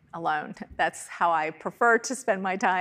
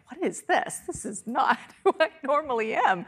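surprised at self; 6 semitones higher than usual. Retold or imitated. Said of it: "And I'm like".

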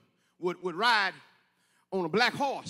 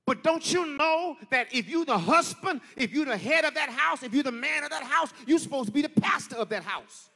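higher, louder whooping sermon cadence; preaching at full force; 6 semitones higher, 4 dB louder than usual. Acted. Preacher urging the congregation, shouting louder and higher. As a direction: build and hammer each line home.

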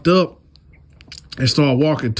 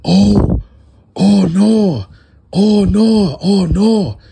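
distressed groaning; 7 semitones higher, 5 dB louder, much slower than usual. Retold or imitated. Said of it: "I just hear him like".

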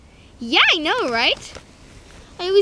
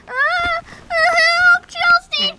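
high, loud pretend panic; mock cry for help; 10 semitones higher, 3 dB louder, much slower than usual. Runaway rider calls her friend for help, high pretend panic. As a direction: call out for help as if the horse bolted.

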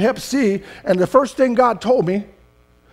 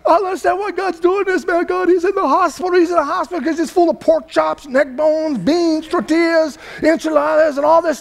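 higher, whiny voice of a complaining believer; mock complainer; 6 semitones higher than usual. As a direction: mimic a wife who blames God. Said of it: "When I hear people say that all the time".

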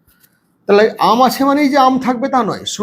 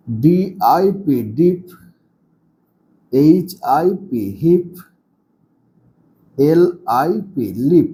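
slow spelling-out; 6 semitones lower, slower than usual. Shown as a demonstration. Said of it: "উচ্চারণ করতে শিখবো".

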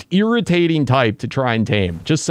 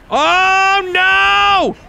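high, loud cry of dismay; dismayed shout; 6 dB louder than usual. Real, not acted. Gamer yells as his creature gets hit, loud dismayed shout. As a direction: yell it as everything goes wrong. Player sees tame in danger.

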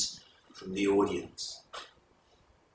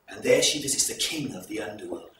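plain reading voice

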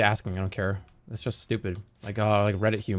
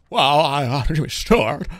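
Jimmy Stewart impression; 4 dB louder than usual. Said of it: "Do Jimmy Stewart".

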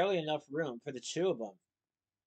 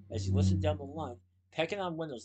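ordinary delivery, nothing marked

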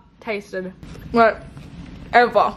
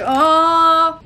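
retold apologetic oh; 5 semitones higher, 5 dB louder than usual. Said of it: "and then she was like".

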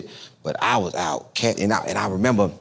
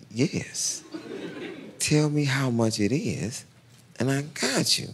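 soft breathy yes then assured offer; soft confident cash claim; 3 semitones higher, 6 dB quieter, slower than usual. Retold or imitated. Telling the salesman he pays cash, soft and assured. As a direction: hush it out, cool and sure. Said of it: "I said".

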